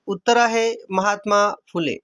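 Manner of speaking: usual speaking voice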